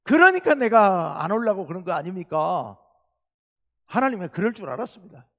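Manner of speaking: loud, indignant protest to God; Jonah's angry outburst. Retold or imitated. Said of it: "성질을 있는 대로 내면서".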